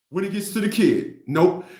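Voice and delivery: ordinary talk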